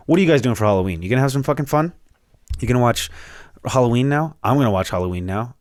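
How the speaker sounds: usual speaking voice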